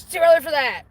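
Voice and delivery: loud, high retold complaint; mock scolding. Matt scolding her, retold.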